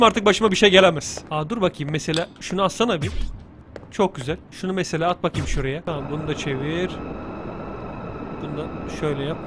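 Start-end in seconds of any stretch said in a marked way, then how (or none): none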